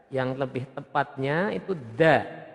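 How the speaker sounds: ordinary delivery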